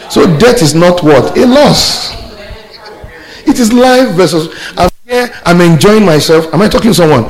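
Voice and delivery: high, loud, triumphant sermon climax; exultant preaching. Real, not acted. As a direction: preach it out to rouse the congregation.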